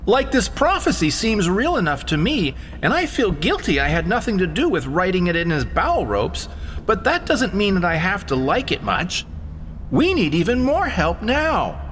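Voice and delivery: put-on high, uneasy boy's voice; a nervous kid backing toward the door; Shawnee's voice. A narrator's reading. Boy backs away with his dog, nervous high voice. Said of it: "said Shawnee, scrambling backwards".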